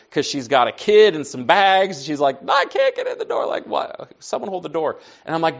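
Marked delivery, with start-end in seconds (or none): 2.4-5.0 mock frustrated wife at the door. Wife stuck outside with kid and bags, mock frustrated. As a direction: imitate her exasperated call for help. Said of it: "Anna's frustrated because she got left outside".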